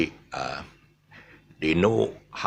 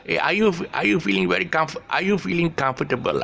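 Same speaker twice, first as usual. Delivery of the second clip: imitated Malaysian English, higher-pitched question; Malaysian pronunciation; 9 semitones higher than usual. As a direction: mimic Manglish with every syllable of comfortable sounded. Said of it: "would pronounce it as comfortable".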